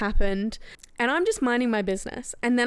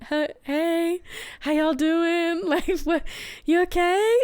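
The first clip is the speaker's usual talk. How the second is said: higher, slow, gentle voice for a scared bird; coaxing the bird; 6 semitones higher, slower than usual. Retold or imitated. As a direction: sweet-talk a frightened animal. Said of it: "And I was like".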